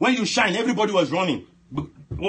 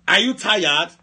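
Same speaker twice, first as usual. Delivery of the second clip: louder, commanding question to the room; authoritative challenge; 5 semitones higher, 7 dB louder, slower than usual. Shown as a demonstration. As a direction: put authority behind the question. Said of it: "so much authority on his voice".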